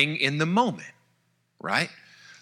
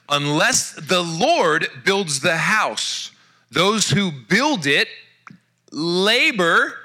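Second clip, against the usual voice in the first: scripture reading; much slower than usual. Acted. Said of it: "Psalm one twenty-seven".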